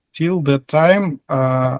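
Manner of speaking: normal talking voice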